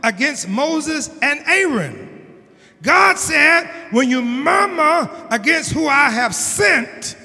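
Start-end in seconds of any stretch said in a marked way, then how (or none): 2.8-7.2 loud, high whooping preacher; full-force preaching. Acted. Pastor preaching God's rebuke to the church at full force. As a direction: drive it home to the congregation.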